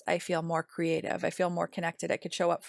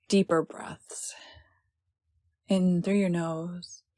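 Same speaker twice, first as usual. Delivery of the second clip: unhurried meditation voice with long pauses; slightly lowered, measured, calming delivery; guided meditation. Acted.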